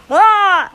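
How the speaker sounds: put-on loud high baby cry; sudden mock baby yell; baby shout. Shown as a demonstration. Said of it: "They go".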